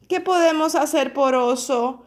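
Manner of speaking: plain reading voice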